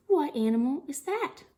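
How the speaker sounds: ordinary talk, nothing marked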